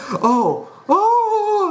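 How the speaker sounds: mock wail, shooting up very high; hammed-up high scream, mocking the character. Mocking a cartoon cloud person's anguish, mock scream. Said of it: "I'm screaming because".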